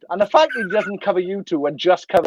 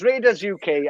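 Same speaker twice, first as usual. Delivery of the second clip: ordinary talk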